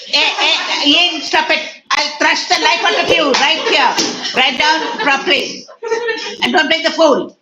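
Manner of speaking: high, loud telling-off; furious scolding. Real, not acted. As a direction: shout down a misbehaving student. Teacher reprimands misbehaving student Ian.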